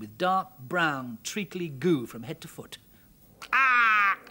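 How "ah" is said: disgusted croak. Acted. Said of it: "said Mortimer".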